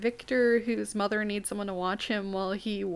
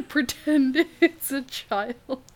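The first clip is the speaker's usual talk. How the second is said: laughing; 4 semitones higher, 6 dB louder, much slower than usual. Real, not acted. Laughing at the bread joke.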